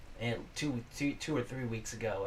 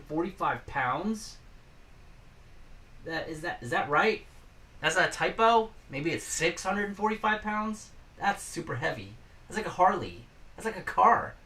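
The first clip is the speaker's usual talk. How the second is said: disbelief; 7 semitones higher than usual. Real, not acted. Shocked at scooter weight.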